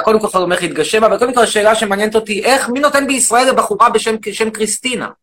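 shouting, indignant rant at the audience; loud mock rant. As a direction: bellow it like an outraged demagogue. Loud persona grills caller.

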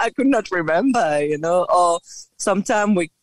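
ordinary talk, nothing marked

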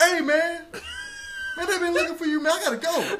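loud, high, frantic call to a coworker; bursting into the break room yelling for him; retold frantic shout, laughing through it; flipping out. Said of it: "I'm flipping out".

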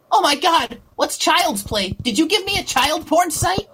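hammed-up, high-pitched alarm; outraged arguing girl. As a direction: imitate a scandalized girl demanding answers. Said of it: "I had this Trumpy girl arguing with me".

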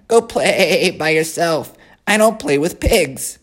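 put-on high, haughty young lamb; a lamb looking down on a pig; snooty lamb voice. The lamb dismisses Wilbur.

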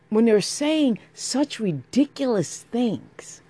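exasperated rant. Real, not acted. Venting fury, raised voice.

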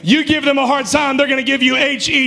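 rally the congregation; booming motivational speech. Acted. Preacher rallies, higher and louder.

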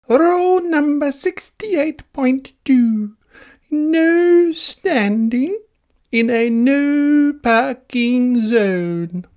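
fussy meter maid reciting a rule; prissy rule reading. Acted. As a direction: read the rule like a proud stickler. Mildred reads rule book.

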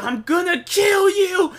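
high strained mock scream of a furious kid; upset kid screaming. Retelling a classmate's threat at the lunch table, high slow mock scream. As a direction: throw a kid's threat out in a high, slow yell. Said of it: "scream out like he is upset".

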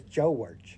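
George drawn out in two syllables, Jaw-erge, falling. Retold or imitated. Correcting a friend, George in two syllables, falling. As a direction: stretch George into two beats, falling at the end. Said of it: "It's two syllables".

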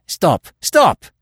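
loud, high cry of protest; desperate high shout. A narrator's reading. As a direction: shout to halt them, voice leaping up. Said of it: "he shouted".

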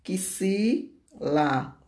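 "a que se lá" slowly split with pauses; slow, segmented "a que se lá"; syllable by syllable. Shown as a demonstration. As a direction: sound out "a que se lá" as pieces of one word. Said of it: "Três sílabas".

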